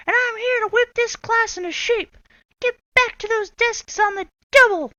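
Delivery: high, loud barked orders; stern witch teacher. Acted. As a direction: whip them into shape. Swamp ordering class.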